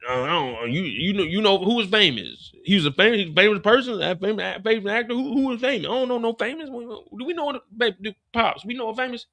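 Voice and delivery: hammed-up clueless act; mock playing dumb. Pops plays dumb about Famous.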